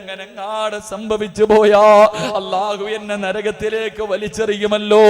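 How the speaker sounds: chanted wail with long-drawn cries; imitated weeping lament. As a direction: lament fearfully in a preacher's sing-song. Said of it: "എന്തിനാ നീ ഇരുന്ന് കരയുന്നത്". (കരച്ചിൽ അനുകരിച്ച് നീട്ടിയ വിലാപം)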